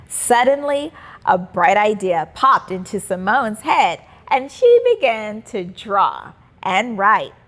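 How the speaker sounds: usual speaking voice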